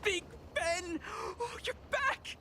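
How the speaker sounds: very high, thrilled squeal of relief; overjoyed reunion cry. Acted. Owner greets lost robot Big Ben, overjoyed.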